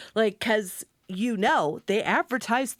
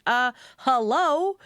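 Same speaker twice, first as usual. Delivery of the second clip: awkward hello; 6 semitones higher, 5 dB louder, slower than usual. Retold or imitated. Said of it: "is like".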